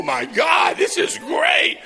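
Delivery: high, loud gushing over a gift; mock delight. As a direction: gush at the top of your range, hammed up. Mimics delight at moms' presents.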